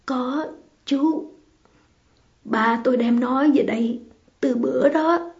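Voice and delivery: understated choked-up character voice. A narrator's reading. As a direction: answer as if holding back tears. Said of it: "bằng giọng nghẹn ngào".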